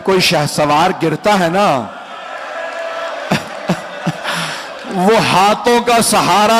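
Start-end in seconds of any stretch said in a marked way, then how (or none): 2.0-4.8 breathy choked wail; a broken sob of grief; soft breathy sob in the elegy; anguished sob. Acted. Wail between narrated grief.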